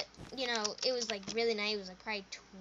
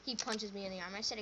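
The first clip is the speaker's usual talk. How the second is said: usual speaking voice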